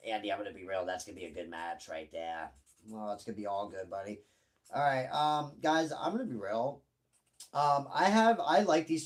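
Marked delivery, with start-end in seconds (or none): 0.1-4.2 second voice. Pretend. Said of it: "I do the two voices".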